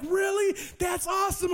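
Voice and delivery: high, delighted amazement; lit up. Retold or imitated. New convert's face lights up at the news, delighted. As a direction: react high and thrilled, imitating the convert. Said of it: "his face just lit up".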